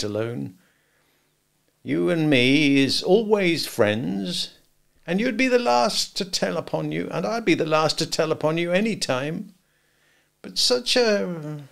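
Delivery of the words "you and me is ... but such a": solemn, confidential. A narrator's reading. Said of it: "speaking in a confidential voice".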